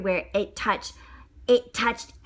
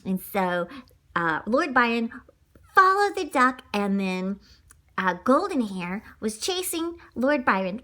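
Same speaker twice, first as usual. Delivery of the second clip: ordinary delivery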